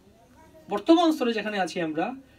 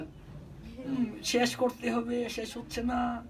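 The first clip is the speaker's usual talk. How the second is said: slow, softer voice of dragging obligation; mock weary grumble; 7 dB quieter, much slower than usual. Devotee grumbling to himself over unfinished rounds, mock weary. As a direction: lightly imitate someone labouring through a chore. Voicing a reluctant chanter's inner grumble.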